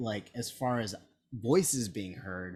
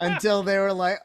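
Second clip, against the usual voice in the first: ordinary talk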